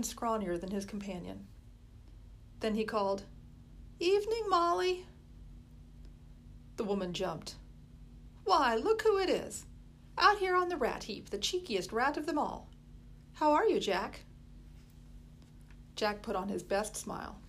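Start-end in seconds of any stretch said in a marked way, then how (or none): 4.0-5.1 lightly voiced boy calling a friendly greeting; a hint of a cheeky lad hailing her; Jack calling out. A narrator's reading. Street boy calling out to Molly, light cheeky greeting. 8.4-14.2 higher, louder teasing market woman; Molly's voice. A narrator's reading. Molly greeting the boy she once cared for, loud teasing.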